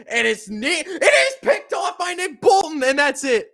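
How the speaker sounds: excited shout. Real, not acted. Fan calling the interception that ends the game, shouting. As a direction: yell out the pick as it happens.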